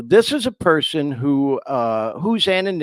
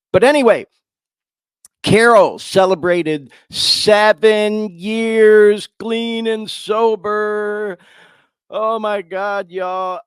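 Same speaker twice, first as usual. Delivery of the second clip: much louder and higher, slow proud proclamation; joyful announcement; 9 semitones higher, 8 dB louder, slower than usual. Real, not acted. Announcing Carol's seven sober years to listeners, loud and proud. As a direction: burst out with the good news, drawing it out. Announcing friend's sobriety milestone.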